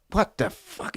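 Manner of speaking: soft, whispery shock; stunned disbelief. Retold or imitated. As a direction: mutter it under your breath, baffled. Retells his stunned reaction to snub.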